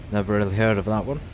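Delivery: ordinary talk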